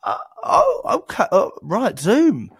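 mock dawning realisation, pitch shooting up; acted surprise. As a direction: play the salesperson acting delighted and surprised. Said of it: "They don't bring the actor".

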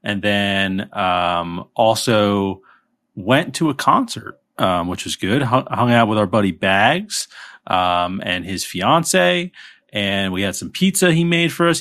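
ordinary delivery

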